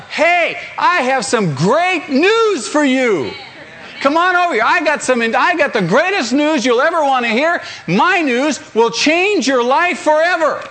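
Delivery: high, jubilant acted call-out; yelling across a distance. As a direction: proclaim great news to people across a parking lot. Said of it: "Yell across the parking lot".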